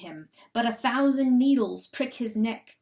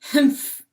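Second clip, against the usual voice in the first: loud grumpy humph; sulking husband huffing at his laughing wife; severe humph; 3 semitones higher, 8 dB louder than usual. Acted. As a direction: snort it out sternly. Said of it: "puts on a very severe expression".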